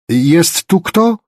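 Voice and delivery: high, slow, loud searching call; drawn-out shouted question. A narrator's reading. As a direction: holler it slowly, lifting the last word. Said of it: "zawołała znowu".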